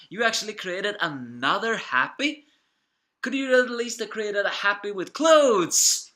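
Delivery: high, loud, incredulous questions; hammed-up indignation; mock outrage. Retold outrage at the author.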